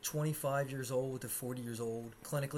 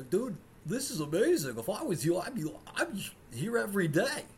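high, loud dopey tourist gushing; mocking tourist voice; 7 semitones higher, 6 dB louder than usual. Mocking the gushing tourists who visit Florida. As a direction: ham up the clueless visitor.

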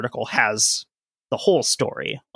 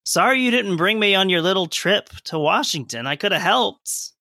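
petty sulking; 6 semitones higher than usual. Pretend. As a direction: sound hurt and passive-aggressive. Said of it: "Dwight Schrute and Michael Scott energy".

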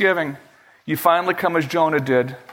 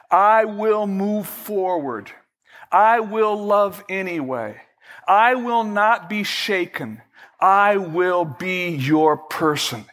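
slow, earnest prayer voice; measured vow to God; 2 semitones higher, slower than usual. Acted. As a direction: pray it out deliberately.

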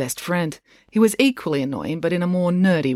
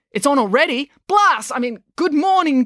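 high startled blurt into forced greeting; flustered; 11 semitones higher, 5 dB louder than usual. A narrator's reading. Mister Lang realises the PA is on, flustered. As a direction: get caught out, then recover. Principal caught live on PA.

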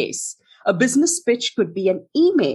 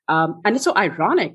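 ordinary talk

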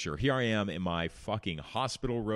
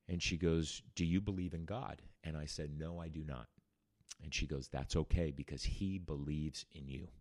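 gentle nurse; 2 semitones lower, 7 dB quieter than usual. Retold or imitated.

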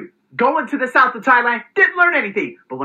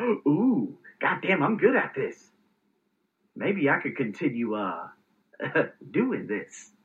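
softer, lower, pleased with himself; quiet self-satisfied murmur; 8 semitones lower, 8 dB quieter, slower than usual. Retold or imitated. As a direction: murmur it like a private boast to yourself. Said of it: "No, I said".

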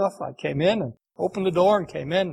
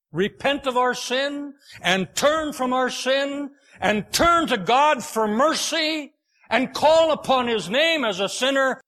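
raised, slow pleading sermon call; preacher urging the old lady to repent, rising earnest voice; preaching; 5 semitones higher than usual. Acted. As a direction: press it home like a revival preacher.

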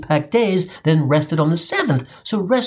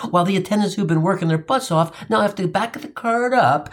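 ordinary talk

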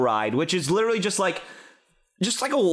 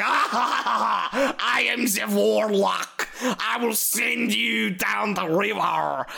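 hammy evil laugh, then slow threat; high, drawn-out villain in a fake accent; fake warlock; 4 semitones higher, slower than usual. Warlock threatening riders at a dead end, hammed-up villain. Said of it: "a fake warlock turns around".

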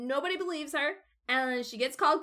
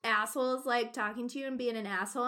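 ordinary delivery

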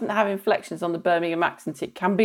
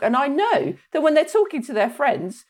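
normal talking voice

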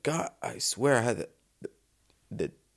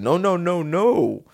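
mock panic; 7 semitones higher, 5 dB louder, slower than usual. Panicking about Brazilian prison.

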